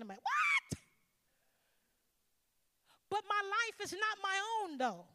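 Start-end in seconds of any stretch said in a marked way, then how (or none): 0.2-0.8 squeaky high shocked What. Retold or imitated. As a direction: jump way up in disbelief. Shocked reply to God.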